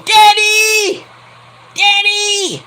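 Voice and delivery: very high, whiny little-princess call; shrieky mock daddy's girl calling out; spoiled daughter. Plays Tiffany calling her daddy.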